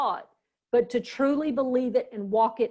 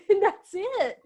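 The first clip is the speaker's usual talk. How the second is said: laughed out, higher and slower; laughing through the sad memory; real laugh breaking into the words; 9 semitones higher, 4 dB louder, slower than usual. Real, not acted. Laughing at her own tearful memory.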